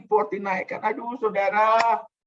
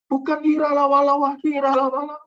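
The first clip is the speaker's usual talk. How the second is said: loud mocking glossolalia, high; shouting nonsense tongues in mockery; mock tongues babble; 9 semitones higher, 5 dB louder than usual. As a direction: parody empty tongues speaking. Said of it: "bahasa roh kita pun jadi generik".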